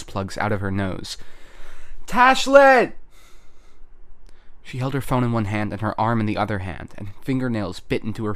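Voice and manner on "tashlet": high, loud yell of a name; full-volume shout. A narrator's reading. Verona shouting for Tashlet with all her lungs, full-volume shout. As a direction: shout as if to burst your lungs. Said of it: "shouting with a volume that felt".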